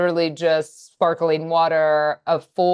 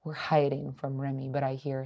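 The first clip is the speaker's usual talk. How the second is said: whisper; 3 semitones lower, 11 dB quieter than usual. Real, not acted. Explaining while hiding, whispering.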